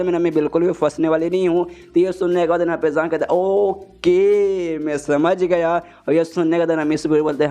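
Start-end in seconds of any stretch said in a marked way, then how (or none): 3.4-5.7 drawn-out, dragged-out words in an odd put-on voice; slow dismissive okay. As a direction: stretch it lazily to brush her off. Jang brushing off Miss Biru.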